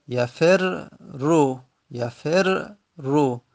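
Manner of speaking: very slowly drawn out; slow demonstration. Shown as a demonstration. Teacher slowly dictating the form to students. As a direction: sound out each letter carefully. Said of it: "اگر مجزوم باید بکنیم".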